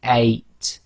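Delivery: eight said slowly with a catch in the throat at the start; a slow eight with a clear glottal onset; slow eight with glottal stop. Shown as a demonstration. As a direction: close the throat briefly before eight. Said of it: "say it very slowly".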